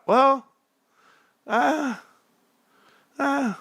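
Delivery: very high, drawn-out hemming; mock evasion. Husband admitting he leaves things out, high mock evasive hemming. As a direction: squeak out a hesitant, guilty non-answer.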